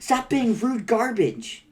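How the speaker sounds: high, slow, emphatic telling-off; indignant scolding. Acted. Scolding Egbert for being rude, outraged. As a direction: drive each word at the snoop. Acted quarrel with an eavesdropper.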